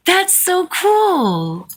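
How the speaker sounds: high, long-drawn admiration; delighted wonder. Real, not acted. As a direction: stretch it out high, really impressed. Delighted at shared family detail.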